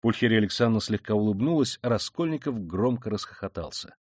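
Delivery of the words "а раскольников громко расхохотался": ordinary delivery, nothing marked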